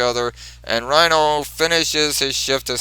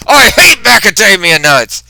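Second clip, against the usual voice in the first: high, loud outburst of disgust; loud high tantrum yell; angry yell; 6 semitones higher, 8 dB louder than usual. Retold or imitated. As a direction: shout the hatred of the nuts. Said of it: "He says".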